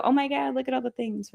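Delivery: high, breathless mock panic over a long list. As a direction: gasp it out like the list is too much. Voicing overwhelmed self at list.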